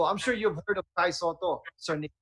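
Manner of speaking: normal talking voice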